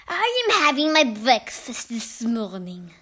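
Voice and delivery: slow, drawn-out witch voice. A narrator's reading. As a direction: declaim it slowly as an imperious witch. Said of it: "cried the Grand High Witch".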